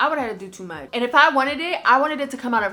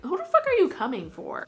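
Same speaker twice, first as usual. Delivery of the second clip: indignant retort; 9 semitones higher than usual. Retold or imitated. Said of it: "I was just like".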